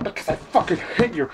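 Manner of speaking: ordinary talk, nothing marked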